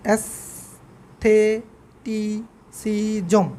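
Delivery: অ্যাস্থেটিসিজম stretched out very slowly; very slow demo. Shown as a demonstration. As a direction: draw out অ্যাস্থেটিসিজম syllable by syllable. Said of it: "এই জায়গাটাতে ভুল করে". (খুব ধীরে টেনে অ্যাস্থেটিসিজম)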